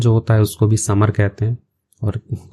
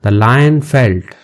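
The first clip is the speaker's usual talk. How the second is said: slow teacher read; 3 dB louder, much slower than usual. Shown as a demonstration. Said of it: "teacher reads slowly".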